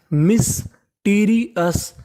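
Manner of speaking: Mysterious very slowly, split as मिस - teary - अस; syllable by syllable. Shown as a demonstration. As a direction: sound out mysterious part by part with gaps. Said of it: "तो meaning बनी". (Mysterious को तोड़-तोड़कर बहुत धीरे)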